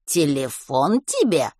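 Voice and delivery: put-on slow, menacing question in an old woman's voice; hostile suspicion. A narrator's reading. Old woman sizing up a boy asking for her phone, hostile. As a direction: draw it out, unfriendly. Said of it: "недобро прищурилась та".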